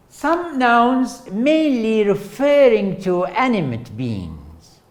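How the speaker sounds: lightly formal lecture read; textbook reading voice. Acted. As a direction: read it off the slide like a formal definition. Reads definition from slide.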